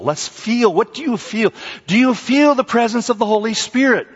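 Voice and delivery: high and loud, urging the crowd; fervent preaching. Acted. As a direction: press the question home. Urging congregation to feel.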